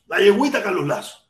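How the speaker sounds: normal talking voice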